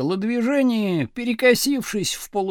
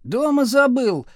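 higher, sullen excuse; sullen declaration; 6 semitones higher, 4 dB louder than usual. A narrator's reading. Pupil excusing his missing diary, sullen. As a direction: state it defiantly and sullenly. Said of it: "хмуро заявил Градусов".

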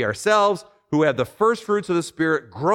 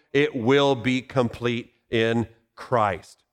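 ordinary delivery

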